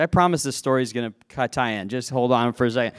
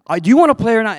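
higher, louder mock coach demanding; angry coach; 7 semitones higher, 3 dB louder, faster than usual. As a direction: badger the kid like a riled coach. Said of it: "I'm not gonna do the accent".

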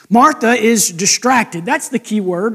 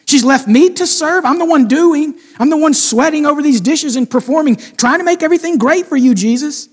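higher, louder aggrieved complaint, faster at the end; jab at Mary; 5 semitones higher, 4 dB louder than usual. Retold or imitated. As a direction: vent like the only one doing the work. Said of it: "jab at Mary now".

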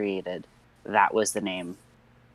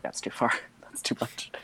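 whispered aside; 6 dB quieter than usual. Retold or imitated. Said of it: "I was like".

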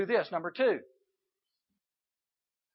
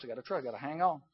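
quiet resolve; 6 semitones lower, 10 dB quieter, much faster than usual. Shown as a demonstration.

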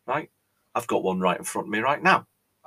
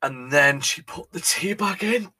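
slow, higher, forcing out a shocking fact; reluctant confession; 8 semitones higher than usual. Acted. Host forcing himself to tell viewers the worst part, slow and pained. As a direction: drag the words out as if they hurt. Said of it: "I can't say it".

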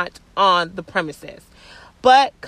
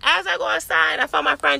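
usual speaking voice

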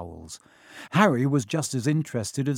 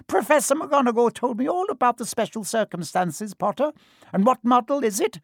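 much higher, squeaky tiny teacher's voice; squeaky little professor; 10 semitones higher than usual. Acted. Small professor breaking up boys arguing, squeaky much higher voice. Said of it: "he squeaked".

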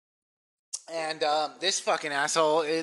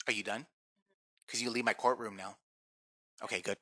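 mock stern quiet; 5 semitones lower, 14 dB quieter than usual. Judge mock after shout.

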